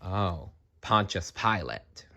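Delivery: ordinary delivery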